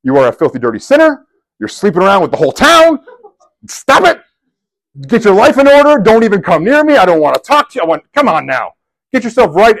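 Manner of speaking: a self-righteous preacher tearing into a sinner; harsh scolding. Retold or imitated. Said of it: "Jesus could have said".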